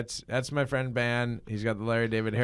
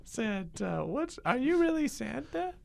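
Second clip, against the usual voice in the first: put-on high, curious kid voice; a wide-eyed kid needling Santa; child's voice; 9 semitones higher than usual. Child up late on Christmas Eve questioning Santa, high kid voice.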